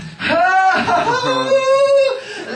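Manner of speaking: high crying laugh; shrieking laugh. Real, not acted. Preacher overcome with joy, a high shrieking laugh. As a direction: break into a wild shrieking laugh.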